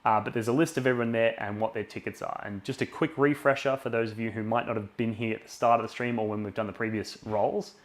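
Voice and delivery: loud, pumped-up stream announcer; hyped giveaway announcement. Acted. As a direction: sell the prize draw with energy. Louder run as he announces the patron draw.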